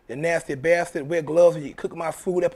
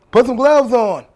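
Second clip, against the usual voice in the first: shouted order, higher and much louder; loud command; 6 semitones higher, 11 dB louder than usual. Acted. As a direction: bark it like a demand. Urging viewers to demand gloves.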